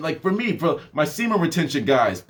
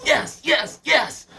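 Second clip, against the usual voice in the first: rising higher into squeaky eager yeses; 9 semitones higher, much slower than usual. Retold or imitated. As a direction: mimic an overeager mom nodding along. Mimics eager mom.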